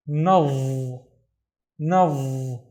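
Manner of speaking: "nav" ending in a long, heavy buzzed dha; vowelless final dha. Shown as a demonstration. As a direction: say "na", then hold a dark voiced th with no vowel. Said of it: "pronounce the dha with no vowels".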